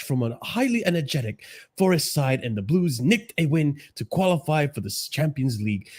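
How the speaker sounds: put-on English accent, reading aloud; mocking English-accented read. Shown as a demonstration. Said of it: "I should read this in an English accent".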